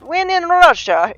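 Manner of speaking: put-on high-pitched, punchy comic Russian accent. Quoting a comedian's joke, in a mock Russian accent. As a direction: imitate a Russian stand-up's catchphrase. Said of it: "He's the one that said".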